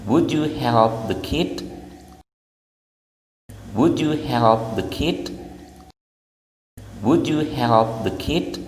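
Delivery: put-on slow "Would you help the kid?", "Would" stressed, pitch rising at the end; rising question tune. Shown as a demonstration. Teacher drilling the class in a rising polite question. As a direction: repeat it slowly, lifting the end. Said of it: "kalimat tanya itu".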